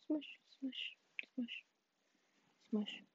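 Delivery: playful breathy whisper of smush; a soft playful whisper. Pretend. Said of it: "whispering playful sounds".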